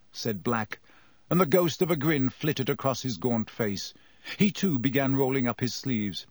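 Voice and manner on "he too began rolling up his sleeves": ordinary delivery, nothing marked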